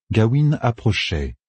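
ordinary delivery

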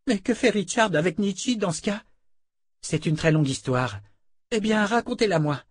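put-on high young voice, relieved; boy's voice. A narrator's reading. Boy learning Gadi died, high and relieved.

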